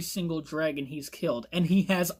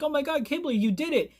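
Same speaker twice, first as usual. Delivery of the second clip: high-pitched excited congratulations, acted; mock excited exclamation; excited onlookers; 7 semitones higher, faster than usual. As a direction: imitate amazed friends cheering someone on.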